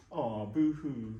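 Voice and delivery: slow, laughing boohoo; mock boohoo. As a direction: tease him with a drawn-out laughing aw. Mocking reply to Boohoo Man.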